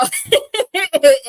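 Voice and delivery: high, loud burst of laughter; laughing. Real, not acted. Storyteller cracking up at her own wild story, laughing. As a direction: break up laughing mid-story.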